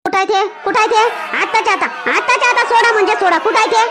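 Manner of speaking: angry demand. Acted. Wife storms into police station.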